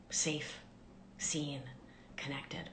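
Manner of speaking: fading hush; soothing whisper. Shown as a demonstration. Teacher soothes the listener's nervous system, whispering. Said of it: "gentle rise and fall of the voice".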